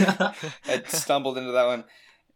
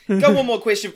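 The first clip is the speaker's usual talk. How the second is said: loud, pushy interruption; yelling over; 8 semitones higher, 10 dB louder than usual. Acted. Both players shout over each other to ask the king. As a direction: shout to be heard.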